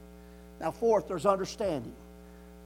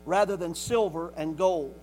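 loud, slow, measured verse reading; scripture proclamation; 8 dB louder, slower than usual. Real, not acted. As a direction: declaim the verse to the congregation.

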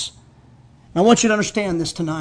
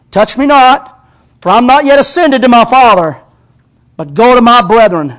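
loud, urgent voice of Jesus; Jesus voiced aloud; 5 semitones higher, 9 dB louder than usual. Preacher voicing Jesus to Mary, loud and urgent. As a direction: proclaim it as Jesus, full force.

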